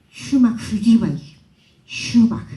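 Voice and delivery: "shumak suyibay" slow, sh at the start; Cañari sh sound. Shown as a demonstration. Quoting the Cañari speakers' quechua for the audience, slow sh. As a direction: drag out "shumak" with a firm sh.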